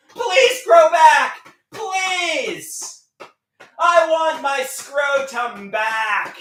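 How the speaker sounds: slow, high, loud begging shout; shouted plea. Acted. Vlogger's song climax, begging loudly and slowly. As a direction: beg at the top of your voice, slowly.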